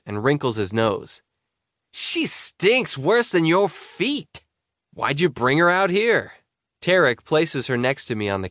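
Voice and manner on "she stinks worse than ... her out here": higher, slow grossed-out friend; lightly voiced squeamish buddy; disgusted teen boy. Tarek voiced by reader.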